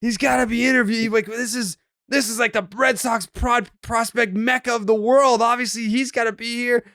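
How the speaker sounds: high-pitched imitation of an eager player; Marcelo Meyer. Player asking hosts for T-Dog, high imitated insistence. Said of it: "He's like".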